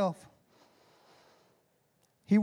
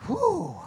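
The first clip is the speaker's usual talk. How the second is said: very high, joyful whoop; excited whoop; 10 semitones higher than usual. Real, not acted. Excited preacher whooping at the congregation, high woo. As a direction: let out a thrilled woo. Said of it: "I'm getting real excited".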